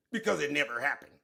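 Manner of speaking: low, mock-solemn lawyer voice. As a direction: parrot the lawyer in a low pompous tone. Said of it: "Look at this stupid ass lawyer".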